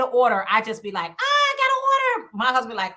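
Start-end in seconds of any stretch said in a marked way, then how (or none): 1.1-2.3 much higher, louder delighted cry; excited squeal. Retold or imitated. Seller hears she got an order, squealing. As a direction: squeal with joy at a new order. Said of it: "I get excited".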